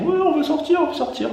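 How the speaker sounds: very high whiny cat voice; cats' voice. Retold or imitated. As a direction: squeak it like pleading cats. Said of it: "les chats, ils font".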